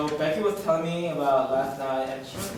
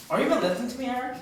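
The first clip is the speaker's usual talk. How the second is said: higher-pitched, offended challenge; indignant; 9 semitones higher, faster than usual. Acted. Girlfriend catches Eric not listening, indignant. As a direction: break off and demand his attention.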